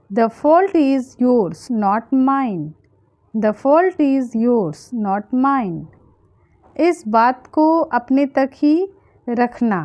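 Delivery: usual speaking voice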